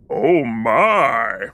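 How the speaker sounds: deep, drawn-out celebrity impression; George Takei. Host performing a listener's requested catchphrase, in deep impression. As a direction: drop the voice low and savour it. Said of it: "your best George Takei voice".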